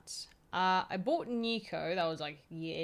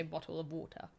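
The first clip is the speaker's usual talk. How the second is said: preferred British way; 3 semitones lower, 7 dB quieter than usual. Shown as a demonstration. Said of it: "which I prefer to say".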